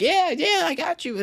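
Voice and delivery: very high little imitation of an agreeable kid; mock kid voice. Kid agreeing to get them a lunch, high mock-eager.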